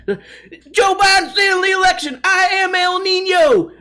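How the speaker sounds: high, loud, shouting Farley imitation; Chris Farley impression. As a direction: yell it like a manic SNL Farley. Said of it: "Chris Farley energy".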